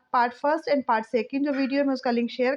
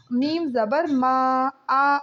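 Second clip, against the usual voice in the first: slow spelling drill; 2 semitones lower, much slower than usual. Shown as a demonstration. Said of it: "زبر میں ہوتی ہے آ".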